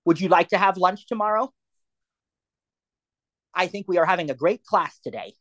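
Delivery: clear, natural dictation to the phone. Shown as a demonstration. Teacher dictating a test message to her phone, speaking naturally. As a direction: talk to the phone like a person, not a robot. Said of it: "don't speak like a robot".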